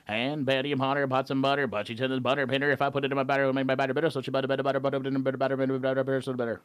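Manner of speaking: rattled off fast with lilting inflection; fast auctioneer-style Betty Botter; fast inflected tongue twister. Shown as a demonstration. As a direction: speed through it like an auctioneer warming up. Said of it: "how to inflect it and make it fast".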